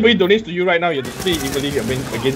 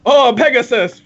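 surprised; 6 semitones higher, 4 dB louder than usual. Real, not acted. Exaggerated reaction to unit name.

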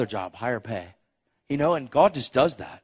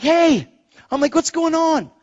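pitched way up, mock-cheery; hammed-up high voice, overdoing the hello; high-pitched nervous greeting; 6 dB louder than usual. Nephew greets his calling aunt, high and overeager.

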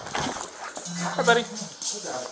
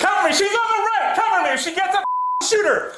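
urgent shout to partner, higher; cover me shout; 6 semitones higher, faster than usual. Real, not acted. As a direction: call out for cover. Officer moving in under fire.

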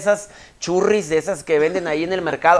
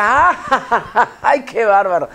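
higher, louder mock laugh; sister's big laugh; 7 semitones higher, 6 dB louder, much slower than usual. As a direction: play the sister laughing at nothing. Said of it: "como mi hermana Magda". (imitando la carcajada de la hermana Magda, más aguda y fuerte)

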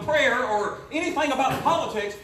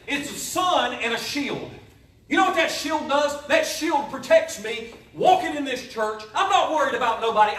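louder, building sermon fervor; preaching at full force; 5 semitones higher, 5 dB louder than usual. Acted. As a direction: lift the room on the shield.

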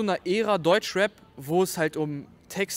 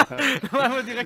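giggling through the words, higher and louder; laughing; 6 semitones higher, 10 dB louder, faster than usual. Real, not acted. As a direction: laugh while you say it. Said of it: "Das hast du aber süß gesagt".

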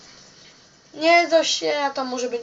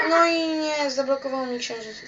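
ordinary delivery

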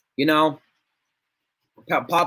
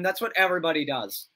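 normal talking voice

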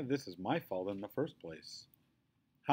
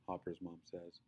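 ordinary delivery

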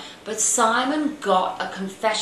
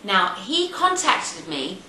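usual speaking voice

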